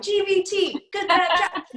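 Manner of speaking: cheerful group yell. Retold or imitated. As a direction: shout it out like a team chant. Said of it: "they all yell out".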